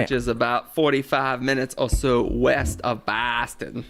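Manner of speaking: dubious mock-Boston accent. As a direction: put on a clumsy Boston accent. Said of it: "That was my Boston accent".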